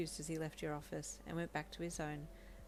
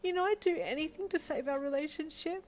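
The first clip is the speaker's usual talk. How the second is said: higher, louder tearful plea; a high, emotional cry begging to talk it out; 11 semitones higher, 4 dB louder than usual. Acted. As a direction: push through the tears, desperate to fix it. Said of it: "you cried".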